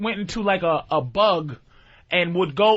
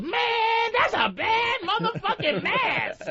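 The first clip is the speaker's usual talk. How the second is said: impressed movie bystander; 13 semitones higher than usual. Acted. New York bystander admiring Jason's mask, hammed-up awe.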